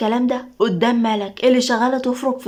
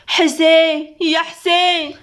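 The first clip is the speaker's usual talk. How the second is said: higher, drawn-out call after someone leaving; 5 semitones higher, 4 dB louder, much slower than usual. Acted. Mother at the open door calls to her son, calling out. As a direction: call his name to stop him.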